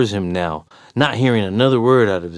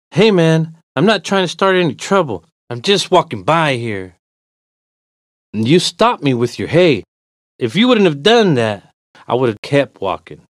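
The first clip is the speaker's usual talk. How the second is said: raised, irritated protest; growing annoyance; 6 semitones higher, 5 dB louder than usual. Acted. Walker tells stranger he's just passing, increasingly annoyed. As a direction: argue back with mounting irritation. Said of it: "my annoyance growing with each word now".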